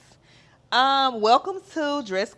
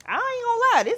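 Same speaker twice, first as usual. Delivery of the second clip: much higher and louder burst; emphatic; 7 dB louder than usual. Real, not acted. Host admitting the podcast haunted her dreams, emphatic burst. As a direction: blurt it out emphatically.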